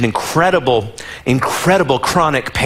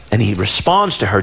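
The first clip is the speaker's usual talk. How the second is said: ordinary delivery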